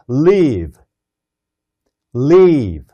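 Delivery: "Live" with a long "ee", the way "leave" sounds. Shown as a demonstration. Teacher modelling "Live" said like "leave" for students to repeat. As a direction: stretch "Live" into "leeve" with a tense throat. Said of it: "한번 따라서 해보세요".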